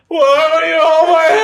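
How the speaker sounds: clingy woman in the back row, mock shriek; mock hysterical plea. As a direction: freak out in a whiny shriek. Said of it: "woman in the back just going".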